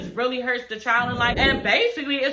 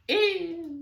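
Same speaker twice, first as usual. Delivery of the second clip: disgusted ew; 7 semitones higher than usual. Real, not acted. Grossed out reaction.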